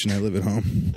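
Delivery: normal talking voice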